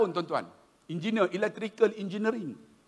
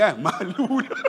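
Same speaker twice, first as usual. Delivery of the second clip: laughing. Real, not acted. Laughing at the shopkeeper's joke.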